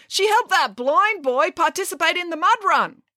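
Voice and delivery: high, enthusiastic crowd voice; calling out. A narrator's reading. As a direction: call out praise from the crowd. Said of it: "called out someone else".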